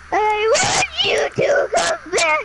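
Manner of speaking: mock crying. Mourning the gorilla to friends, mock crying shout. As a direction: ham up a tearful plea. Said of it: "I'm so sad".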